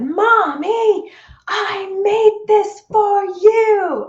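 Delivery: put-on high, loud, slow childlike yell; a little girl shouting to get her mother's attention; child yelling. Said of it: "And she yelled it".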